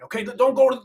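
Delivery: ordinary talk, nothing marked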